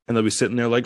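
ordinary talk

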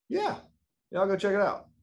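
very high, sheepish yeah yeah; sheepish evasion. Retold or imitated. Son dodging dad's question, sheepish high evasive reply. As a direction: dodge the question lightly. Vague answer to dad.

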